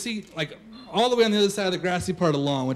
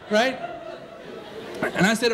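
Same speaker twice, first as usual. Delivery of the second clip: normal talking voice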